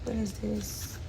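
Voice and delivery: ordinary talk, nothing marked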